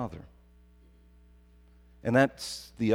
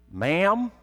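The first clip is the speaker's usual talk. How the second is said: high, loud southern "Ma'am" with a drawl; 8 semitones higher, 7 dB louder than usual. Retold or imitated. Friend from Alabama answering his mother, southern drawl. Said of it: "he had this southern accent, southern drawl".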